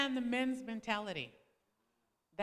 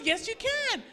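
higher and louder, playful insistence; husband insisting; 9 semitones higher, 6 dB louder than usual. Retold or imitated. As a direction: push back on her. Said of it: "He goes".

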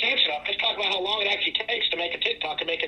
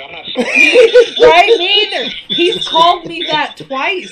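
loud high laughter through words; cracking up while refusing; laughing; 11 semitones higher, 14 dB louder, slower than usual. Real, not acted. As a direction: friends tease him about a word, laughing loudly. Laughing over a joke trap.

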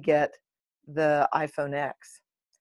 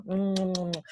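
string of quick smacky kisses; noisy little air kisses; kissing sounds; 7 dB quieter than usual. Pretend. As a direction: kiss the phone over and over, mwah. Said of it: "I'm kissing my phone".

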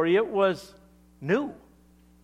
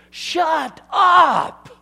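loud, much higher Shut up!; delighted shout; 11 semitones higher, 13 dB louder than usual. Retold or imitated. Wife hears her husband got hired, shouting in delight. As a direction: yell it in happy disbelief. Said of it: "she goes".

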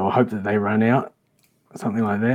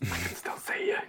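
whisper; 4 semitones lower, 12 dB quieter than usual. Acted. Whispered in-character reply.

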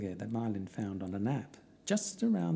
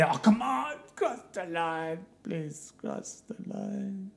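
pleading dog; 8 semitones higher, slower than usual. Acted. Dog begging the pilgrim to cross the line, whiny pleading. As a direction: let the dare wilt into a drawn-out plea. Dog's dare collapses into begging.

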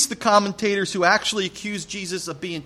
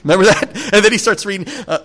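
usual speaking voice

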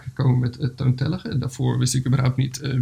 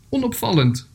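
calling back; 8 semitones higher than usual. Acted. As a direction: call back lightly on a high note. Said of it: "riep de mier terug".

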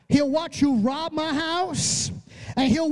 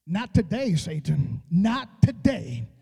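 much lower, slow and steady; low, firm David; 11 semitones lower, slower than usual. Retold or imitated. David answering the giant, low and slow. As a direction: drop the voice low and state it slowly. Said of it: "David looked at him and said".

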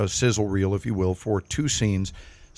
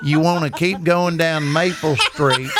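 Texan giving directions; 5 semitones higher than usual. Retold or imitated. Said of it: "and the guy goes".

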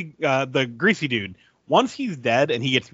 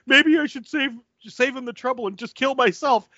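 imitating panicky Chicken Boy; 8 semitones higher than usual. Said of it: "He goes".

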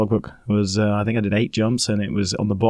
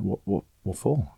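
puzzled mumble; 2 semitones lower, 7 dB quieter than usual. Retold or imitated. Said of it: "and I was like".

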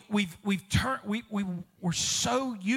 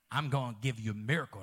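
God's promise; 12 semitones lower, 6 dB quieter, faster than usual. Retold or imitated. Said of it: "if you heard".

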